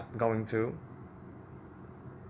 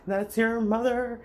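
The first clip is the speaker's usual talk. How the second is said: much higher, louder and slow; mocking refrain; 11 semitones higher, 4 dB louder than usual. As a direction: drag out the nagging refrain to a peak. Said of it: "everyone wants to say".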